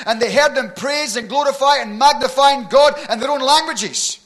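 loud, high, impassioned preacher delivery; full-force preaching. Real, not acted. Preacher telling the church of Pentecost, full-force preaching. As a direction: preach it out to the whole room.

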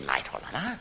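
put-on low-key New Zealand accent, dropped soft; softly voiced New Zealander; Kiwi accent. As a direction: mumble it lightly as a Kiwi bloke. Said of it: "The Kiwi said".

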